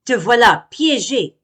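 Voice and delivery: gloating yell; triumphant cry. Acted. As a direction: gloat at the trapped bee. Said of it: "crie Monsieur Ours".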